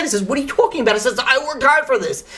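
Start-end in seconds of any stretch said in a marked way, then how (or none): 0.2-0.9 high-pitched, mildly indignant retort; getting upset. Retold or imitated. Zev rebuffing his old friend, getting upset. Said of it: "Zev starts getting upset". 1.2-2.2 high, indignant retold defence; getting upset. Zev defending his hard-earned money, getting upset. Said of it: "Zev starts getting upset".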